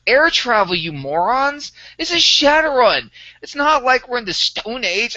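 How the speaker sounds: hammed-up scolding of clueless teammates; mock exasperated rant. Player replaying his in-character rant at the table, mock exasperated. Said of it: "And I'm like".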